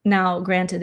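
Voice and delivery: ordinary talk, nothing marked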